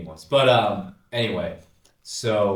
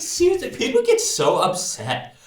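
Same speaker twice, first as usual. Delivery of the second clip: imitating Vanessa; 3 semitones higher, 5 dB louder than usual. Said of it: "she was like".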